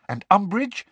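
high, worried question; nervous, checking for danger; anxious. A narrator's reading. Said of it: "said Hermione anxiously".

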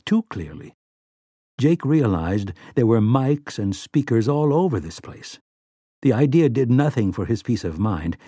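plain reading voice

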